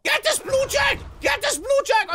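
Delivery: high, loud attack order; urgent battle shout. Real, not acted. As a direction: shout to charge the enemy. Sudden attack on an enemy.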